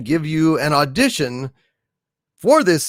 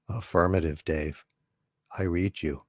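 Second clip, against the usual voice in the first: much lower and much softer, calm and even; HAL computer voice; 11 semitones lower, 11 dB quieter than usual. Acted. The ship computer answering astronaut Dave, low, calm and soft. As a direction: speak low, soft and unhurried like a polite machine. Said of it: "from two thousand and one, A Space Odyssey".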